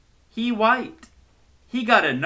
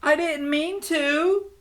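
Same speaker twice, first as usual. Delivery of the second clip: crying. Acted. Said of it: "the girl cried".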